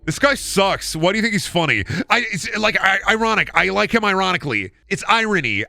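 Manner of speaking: high-pitched, flustered mock protest; acted defensive protest. Acts out fan defending himself to friends.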